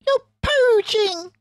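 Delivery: very high, loud squealing rat; shrieking Rat. Acted. Rat shrieks at fox and badger for poaching. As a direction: shriek it in outrage. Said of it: "shrieked Rat".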